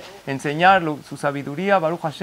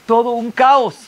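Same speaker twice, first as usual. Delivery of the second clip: excited chaos; 6 semitones higher, 6 dB louder than usual. Retold or imitated. Describing the chaos at the circumcision.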